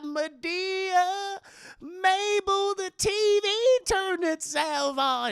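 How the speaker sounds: high, loud, drawn-out wail; scared old aunt. Acted. Aunt Hattie calling Madea about the TV, high frightened wail.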